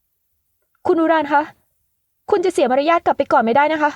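high, drawn-out calling his name, scolding; stern call. Acted. Young woman calling after the man who walked out, stern and high. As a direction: call him back firmly like a lady scolding. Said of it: "เรียกเขาเสียงเข้ม".

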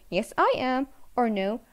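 ordinary delivery

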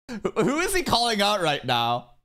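high-pitched, incredulous questioning. Real, not acted. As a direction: demand to know, voice shooting up. Amused disbelief at the VTuber jab.

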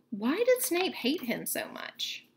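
usual speaking voice

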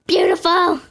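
high, laughing exclamation; laughing delight. Real, not acted. Gamer admiring earrings, high laughing delight. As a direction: burst out with delight.

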